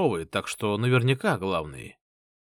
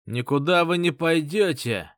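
sharp raised cry; shouting; 5 semitones higher, 3 dB louder than usual. Acted. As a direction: yell it at them. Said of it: "вскричал какой-то паренёк".

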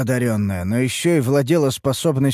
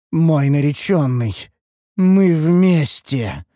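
slow, eerie, booming elf voice; drawn-out possessed whisper-roar; otherworldly voice; much slower than usual. A narrator's reading. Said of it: "заявила эльфийка потусторонним голосом".

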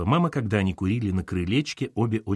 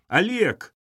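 high-pitched cry of the name; shouting; 11 semitones higher, 4 dB louder than usual. A narrator's reading. Katerina cries out to boss on phone, shouting. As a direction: call out sharply, pitch shooting up. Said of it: "закричала Катерина".

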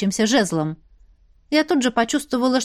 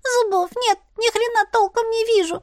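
much higher, squeaky little grumbler; squeaky kitten voice; 9 semitones higher than usual. Acted. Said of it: "писклявым голосочком".

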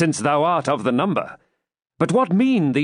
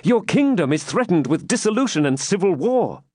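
high, earnest remonstrance; impassioned, high-pitched plea; urgent warning; 10 semitones higher than usual. A narrator's reading. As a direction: warn your king with feeling. Knight warns king of danger.

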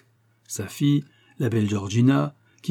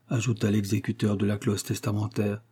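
ordinary delivery, nothing marked

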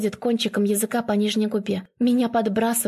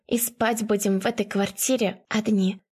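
plain reading voice

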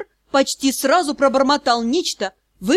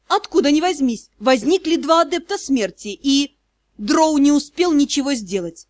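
ordinary delivery, nothing marked

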